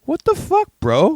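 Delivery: high, loud incredulous squeal; hammed-up shocked dad; imitating appalled dad. Dad reacting to his kid, loud mock disbelief. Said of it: "like, dad's face, and dad's like".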